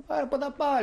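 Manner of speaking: father exclaiming where could he possibly go; incredulous retort. Retold or imitated. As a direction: throw the question back, half amused. Father retorting to German's threat.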